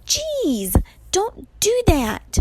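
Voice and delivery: very high, drawn-out exclamation; jumpy, exasperated complaint; startled protest. A narrator's reading. Percy has been startled by Annabeth, protesting high.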